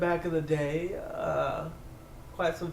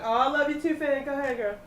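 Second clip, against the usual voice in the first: high, fond call back; sweet reply; 9 semitones higher than usual. Acted. Gloria calling back her love to Finn, high and sweet. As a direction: answer him affectionately. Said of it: "she's like".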